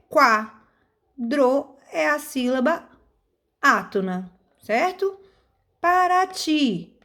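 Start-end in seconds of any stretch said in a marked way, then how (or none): 5.8-7.0 "Para ti" slow and higher, final "ti" held; stress on TI. Shown as a demonstration. Teacher showing pupils the strongest syllable, "Para ti" with stressed TI. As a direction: land long on the "ti" of "Para ti". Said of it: "Ti é a sílaba mais forte".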